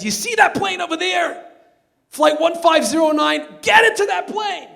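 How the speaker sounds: high, loud barked orders across the tarmac; urgent shouting. Retold or imitated. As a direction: yell it over engine noise, commanding. Janitor orders truck driver urgently.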